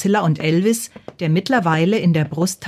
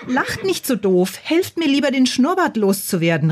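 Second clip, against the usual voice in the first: higher-pitched, mildly cross plea; a touch of exasperation without shouting; calling out; 7 semitones higher than usual. A narrator's reading. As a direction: call out in protest, pitch raised. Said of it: "rief Bacilla".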